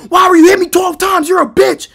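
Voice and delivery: high, loud, fast accusing yell; acted-out outraged shout. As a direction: reenact an uncalmed, furious outburst. Yells until told to calm down.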